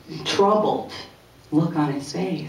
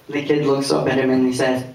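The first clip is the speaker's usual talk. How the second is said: ordinary talk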